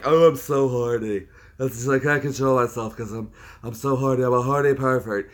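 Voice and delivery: lampoon the troll's whining; mock pervert whine; mock horny troll. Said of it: "to troll, like".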